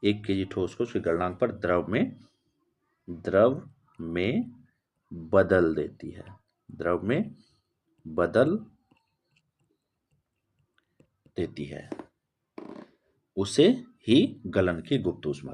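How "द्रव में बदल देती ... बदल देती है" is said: word by word with long pauses; slow dictation. Real, not acted. Teacher dictating a definition to students, slow dictation. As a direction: dictate slowly, leaving time to write.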